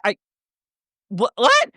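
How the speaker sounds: a high, sputtering what; stunned disbelief. Retold or imitated. To the annoyed boyfriend, high stunned what. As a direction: caught off guard, can't believe he asked. Stunned reply to his question.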